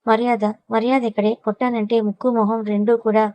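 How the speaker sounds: ordinary delivery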